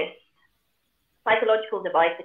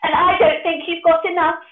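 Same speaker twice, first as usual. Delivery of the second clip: high, louder mocking pig voice; cheeky piggy; 11 semitones higher, 6 dB louder than usual. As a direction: tease the wolf in a high little pig voice. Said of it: "pig said".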